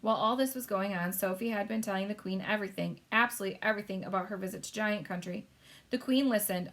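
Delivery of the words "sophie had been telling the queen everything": ordinary delivery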